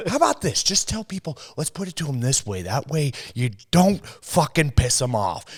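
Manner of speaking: mock host bossing the guest; hammed-up TV host giving orders; pushy host. Host coaching the expert.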